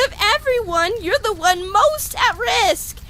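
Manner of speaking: high, stammering fretting; panicked worry. Acted. Worrying over a frail patient, panicked. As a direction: let the worry spiral upward.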